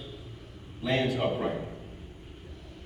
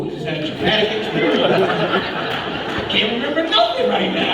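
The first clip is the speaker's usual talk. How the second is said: loud, higher protest through laughter; mock-exasperated, breaking into a laugh; laughing exasperation; 5 semitones higher, 5 dB louder than usual. Acts out incredulous inner protest, laughing.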